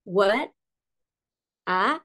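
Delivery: WHAT and A each rising; second tone. Shown as a demonstration. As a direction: let what, a glide upward like questions. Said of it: "kalau nada dua". (what, a dengan nada dua yang naik)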